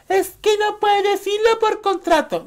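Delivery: high mock voice of an excuse-maker. Vlogger mocking people who defend the silence, high mock voice. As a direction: parrot the lame excuse in a high silly voice.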